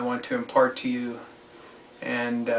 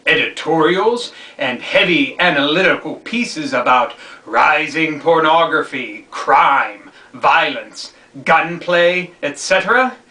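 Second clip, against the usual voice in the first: loud, higher-pitched dramatic reading; emphatic read-aloud; 5 semitones higher, 10 dB louder than usual. Acted. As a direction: declaim the passage like an editorial. Reads a book excerpt aloud.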